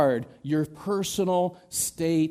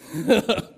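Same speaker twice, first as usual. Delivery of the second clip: short laugh; 5 semitones higher, 6 dB louder than usual. Real, not acted. Laughs at own joke.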